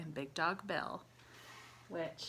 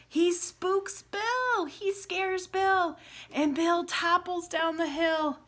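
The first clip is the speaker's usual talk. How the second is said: much higher, louder, slow dramatic telling; spooky drama; 10 semitones higher, 6 dB louder, slower than usual. Acted. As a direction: draw out the scare. Bunny scares the dog.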